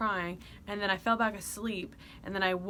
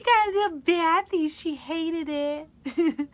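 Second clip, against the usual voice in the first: put-on baby talk; 8 semitones higher, 8 dB louder, slower than usual.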